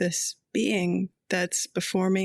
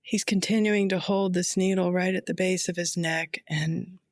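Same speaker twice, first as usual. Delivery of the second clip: ordinary talk, nothing marked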